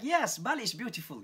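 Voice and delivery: mock the students' sudden delight; retold student shout. Students shouting back at the lecturer, a mild mock excited cry. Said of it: "Baru semua teriak".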